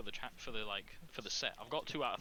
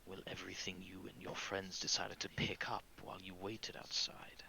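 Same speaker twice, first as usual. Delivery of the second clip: menacing villain; 3 semitones lower, 4 dB quieter than usual. Acted. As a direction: explain it softly, with veiled threat. Villain answers in character.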